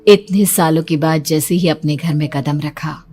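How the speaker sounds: ordinary delivery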